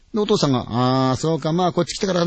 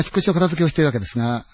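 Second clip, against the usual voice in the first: ordinary delivery, nothing marked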